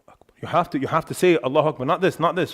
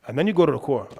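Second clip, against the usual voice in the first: normal talking voice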